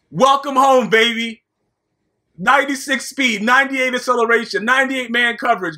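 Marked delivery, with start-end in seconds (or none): none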